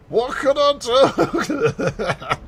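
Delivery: high, slow drawn-out whine; hammed-up child whine; whiny kid. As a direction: stretch it out like a pestering child. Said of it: "With that intonation".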